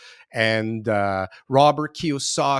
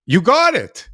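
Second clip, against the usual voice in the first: loud, high, eager yes from the agent; hammed-up eager agent voice; 8 semitones higher, 10 dB louder than usual. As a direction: play the thrilled agent grabbing a blank check. Agent accepts a blank check.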